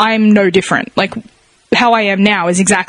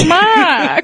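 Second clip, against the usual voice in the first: exaggerated Australian Mark; Australian accent; 7 semitones higher than usual. Shown as a demonstration. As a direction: Mark drawn out high, Aussie style. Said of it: "Now I can't stop saying it".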